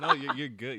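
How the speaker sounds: reassuring her through a laugh, high and loud at first; laughing reassurance. Real, not acted. Interviewer to the embarrassed guest, laughing reassurance. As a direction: laugh it off kindly.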